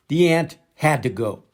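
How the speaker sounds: ordinary delivery, nothing marked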